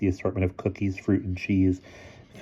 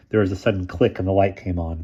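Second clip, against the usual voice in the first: ordinary delivery, nothing marked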